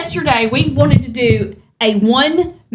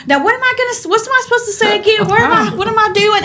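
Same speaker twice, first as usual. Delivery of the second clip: higher-pitched flustered self-mimicry; flustered retake; 9 semitones higher than usual. Woman recounting her botched video takes, flustered. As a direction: voice pitched up, losing track of the line.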